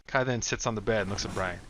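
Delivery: plain reading voice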